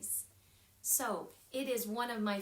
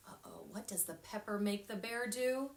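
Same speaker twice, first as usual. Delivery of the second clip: usual speaking voice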